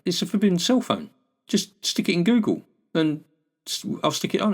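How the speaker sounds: high mock jobsworth clerk, lightly voiced. Mimicking the post office clerk who refuses to google, high mock voice.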